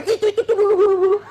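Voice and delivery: high, loud, hammed-up wife ranting; mock wife ranting. Preacher mimics a wife ranting about old fights, high and loud. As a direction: pitch way up and rant about the past.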